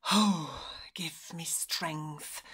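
low, soft, weary groan. A narrator's reading. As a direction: groan it slowly under the breath. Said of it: "groaned the old wolf".

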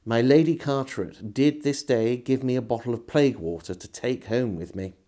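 usual speaking voice

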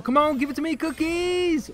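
high, pleading excited call; eager plea. Real, not acted. Gamer begging the game for a drop, eager. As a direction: urge it on, voice jumping up. Begging for the item mid-fight.

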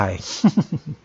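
a short teasing laugh after a spooky hint; teasing chuckle. Acted. Man teases the woman after hinting at dark secrets, light chuckle. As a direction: chuckle to break the tension.

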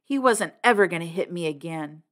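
plain reading voice

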